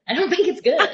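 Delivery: high-pitched, through real laughter; laughing. Real, not acted. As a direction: let the laugh break into the words. Joking about losing sleep over Avatar.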